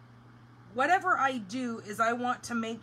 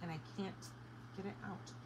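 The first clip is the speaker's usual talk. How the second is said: frog in throat; 2 semitones lower, 13 dB quieter, faster than usual. Real, not acted. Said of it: "a frog in my throat".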